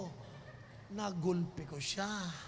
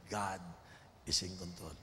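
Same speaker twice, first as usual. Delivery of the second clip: solemn low statement; 10 semitones lower, 5 dB quieter than usual. Acted. Low declaration.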